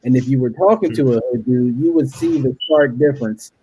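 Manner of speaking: ordinary delivery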